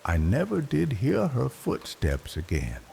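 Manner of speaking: plain reading voice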